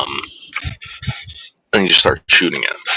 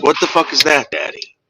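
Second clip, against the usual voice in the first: higher, mock dog voice; the dog asking its owner, higher and puzzled; voicing the dog; 7 semitones higher than usual. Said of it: "Wishbone's like".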